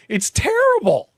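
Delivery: very high, drawn-out, loud verdict; exasperated outcry. Real, not acted. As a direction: wail the verdict slowly in exasperation. Repeating verdict to disagreeing co-host.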